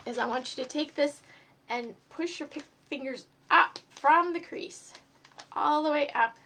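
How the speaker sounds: usual speaking voice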